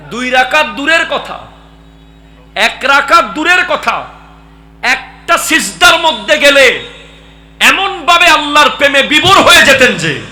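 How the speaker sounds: high, very loud, building exhortation; full-force preaching. Real, not acted. Preacher describes being lost in love of Allah, full-force preaching. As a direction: belt out each phrase, climbing in intensity.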